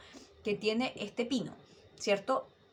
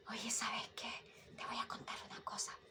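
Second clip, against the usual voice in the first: much softer, breathy secret whisper; whispered gossip; 14 dB quieter than usual. Shown as a demonstration. Teacher demonstrating airy falsetto as a gossip's whisper. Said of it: "Esto es hablar con falsete".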